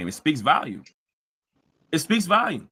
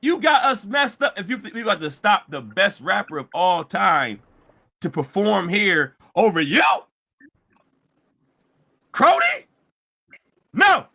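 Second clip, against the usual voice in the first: retold shout. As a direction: bark it out like an outraged promoter. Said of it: "Roger said, he said".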